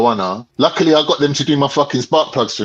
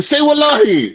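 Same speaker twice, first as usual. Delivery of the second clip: much higher insistent demand; 13 semitones higher than usual. Retold or imitated. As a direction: push him to swear it. Demanding he swear in story.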